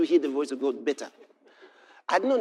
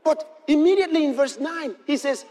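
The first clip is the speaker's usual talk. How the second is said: preaching at full force; 11 semitones higher, 5 dB louder than usual. Acted. Preacher proclaiming scripture to the congregation, shouting. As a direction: thunder the verse at them.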